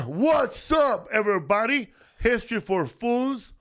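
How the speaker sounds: high, loud host's holler; shouted show intro. Real, not acted. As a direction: belt out the greeting, drawing out the show name. Podcast host shouts show opening.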